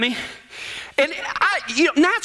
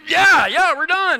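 loud, high exasperated yes; impatient insistence; 7 semitones higher, 3 dB louder than usual. Retold or imitated. Gnat-covered cowboy answers his dawdling buddy, loudly impatient. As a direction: hurry him along, fed up.

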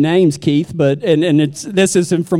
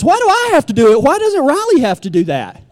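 higher, quicker whine of protest; whiny kid protest; 5 semitones higher than usual. Retold or imitated. Dad mimics his son balking at a chore, whiny protest. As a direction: complain like a put-upon child.

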